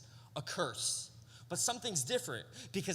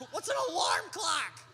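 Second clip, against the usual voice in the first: mock bafflement; 11 semitones higher, 4 dB louder than usual. Mocking own cluelessness.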